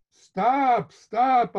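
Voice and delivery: high, loud pleading stop; a son crying out to make her stop; boy shouting stop. Retold or imitated. Boy cries out at mother.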